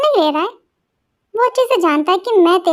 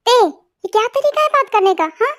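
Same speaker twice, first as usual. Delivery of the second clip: scolding; 4 semitones higher than usual. Acted. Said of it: "आप मुझे चिल्ला रही हैं?".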